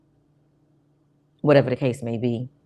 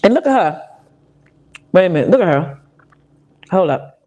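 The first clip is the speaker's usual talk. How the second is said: excited; 4 semitones higher, 7 dB louder, faster than usual. Real, not acted. Calling out at the video.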